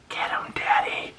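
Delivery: soft, voiceless imitated daughter's whisper. Daughter whispering to her dad Frank, imitated whisper. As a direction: whisper it into his ear. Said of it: "his daughter whispering in his ear".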